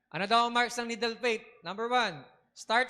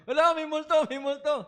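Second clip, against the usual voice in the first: high, loud frightened cry of ghost; scared cry; 8 semitones higher, 5 dB louder than usual. Acted. Frightened disciple cries ghost. (takot na sigaw)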